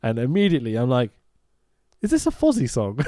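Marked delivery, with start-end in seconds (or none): 2.0-3.0 high, startled question; hearing the soundtrack, surprised; surprised recognition. Retold or imitated. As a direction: catch yourself recognising the song.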